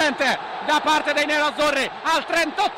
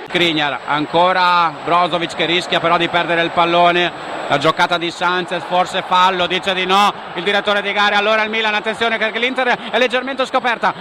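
lower, louder driving commentary; live play-by-play; 8 semitones lower, 5 dB louder than usual. Acted. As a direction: push the action forward. (cronaca incalzante)

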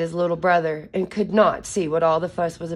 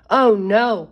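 alarmed high cry of Oh no; a chick crying out in alarm; cried out; 4 semitones higher, 7 dB louder than usual. Acted. Chick cries out in alarm after losing his brother, loud and higher. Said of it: "cried Chick".

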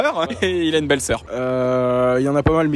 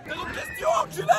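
shouting; 9 dB quieter than usual. Real, not acted. Hurlé sur le manège.

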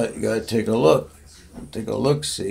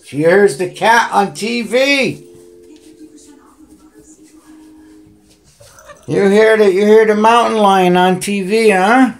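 higher, louder, eager; loud cooing at the cat; loud excited pet talk; 8 semitones higher, 10 dB louder than usual. Real, not acted.

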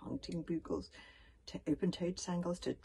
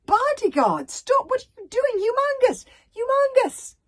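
much higher, louder panicked shouts; panicked yelling; 17 dB louder than usual. A narrator's reading. Hiccup shouting at the bodyguard to stop, panicked. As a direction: scream to stop him.

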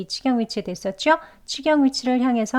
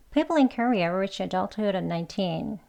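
sentence run together quicker, adulthood linked; faster; 5 dB quieter, much slower than usual. Shown as a demonstration. Teacher modelling the sentence quickly for learners. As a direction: say the sentence at a brisk natural pace. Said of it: "한 번 더요".

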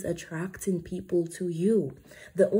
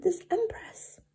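whisper; 13 semitones higher than usual. Acted. Dramatic aside in reading.

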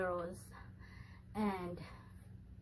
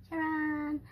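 high, drawn-out ta-da; ta-da reveal; 7 semitones higher, 4 dB louder than usual. Real, not acted. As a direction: present it with a singsong flourish. Ta-da as she presents package.

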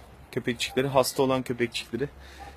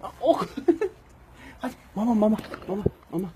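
laughing high call to puppies; 9 semitones higher, faster than usual. Real, not acted. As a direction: giggle while calling them to food. Laughing, calling puppies to food.

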